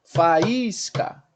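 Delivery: "Faísca" stretched as fa-ÍS-ca; syllable separation. Shown as a demonstration. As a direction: split A from a long stressed I in "Faísca". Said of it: "Eu tenho três sílabas". ("Faísca" em três sílabas bem separadas)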